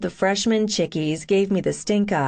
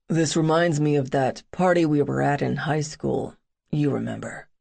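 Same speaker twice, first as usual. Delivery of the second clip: put-on brooding young man; 4 semitones lower than usual. Boone voiced by narrator.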